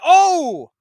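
a loud, high "oh" of sudden remembering; sudden realization. Real, not acted. Comic remembering his own list, sudden loud realization. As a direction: it just came back to him, light up.

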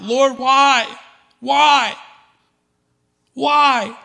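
loud, high anguished cry of why; repeated anguished why, hurled upward; crying out to God. Retold or imitated. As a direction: cry it out to heaven, pleading. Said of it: "me crying out".

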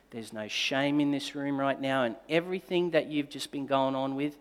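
a hint of a parent's comfort; tender words of love. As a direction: speak it lovingly and quietly. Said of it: "I just spoke over her the words of love".

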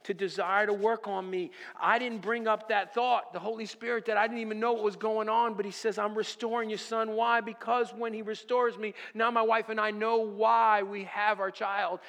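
building pulpit sermon voice; preaching. Acted. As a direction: preach it out to the congregation with fire. Preaching to congregation.

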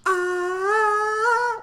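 high loud party yell; a loud high whoop like a fist pump; mock party whoop. Picturing himself partying in Mexico, a high loud whoop.

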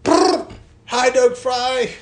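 very high, loud slow drive-by shout. Retold or imitated. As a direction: yell the whole name from a passing car. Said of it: "You gotta say his whole name".

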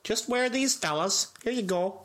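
high put-on coaxing voice; pleading team helper. Acting out handing bandages to players, high coaxing voice. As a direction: coax the players in a mock high voice.

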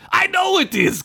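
very high exasperated cry; high-pitched acted yell. A narrator's reading. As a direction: squawk it back defensively. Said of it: "Gunk yelled".

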